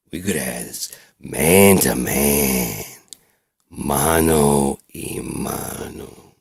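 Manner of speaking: loud, drawn-out indignant threat; seething rage. Acted. Patient confronts doctor who ratted him out, seething. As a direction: rant slowly and forcefully at the betrayer. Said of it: "I was so pissed".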